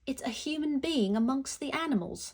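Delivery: a playful posh-British voice; a quick put-on British accent. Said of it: "I don't know why my brain is British".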